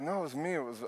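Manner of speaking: soft, fumbling fib; mock quiet insistence. Husband claiming he made the noise, soft insistence. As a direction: imitate insisting under the breath. Acting his fib at night.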